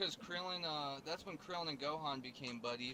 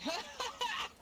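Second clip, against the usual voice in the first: higher villain laugh; evil high cackle. Retold or imitated. Voicing Raditz laughing.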